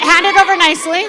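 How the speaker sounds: fast, high, bossy demand. Acted. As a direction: snap it out like a spoiled master. Rich man demanding food.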